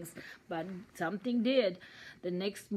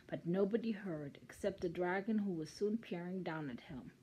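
usual speaking voice

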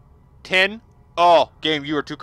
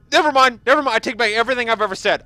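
excited retraction; 6 semitones higher, 7 dB louder, faster than usual. Real, not acted. Takes back complaints.

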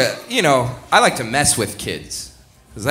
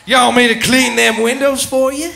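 put-on suggestive Southern accent, pitched up; 10 semitones higher, 4 dB louder than usual. Southerner offering to clean windows, suggestive Southern accent. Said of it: "everything you say in a Southern accent is sexual".

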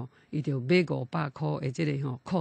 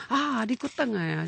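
retold surprise; 6 semitones higher than usual. Father seeing his dead son return, acted surprise. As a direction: imitate an amazed parent.

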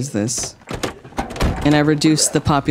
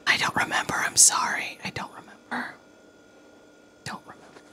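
breathy sheepish whisper; 11 dB quieter than usual. Real, not acted. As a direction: whisper it, embarrassed. Unvoiced whisper.

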